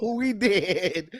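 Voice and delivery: teasing, stretched-out imitation; amused mimicking. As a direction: repeat your friend's line slowly for laughs. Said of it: "He said".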